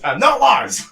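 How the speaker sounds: loud, high protest; indignant shout. Real, not acted. Co-host rejecting a nickname, indignant shout. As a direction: yell back at the teasing.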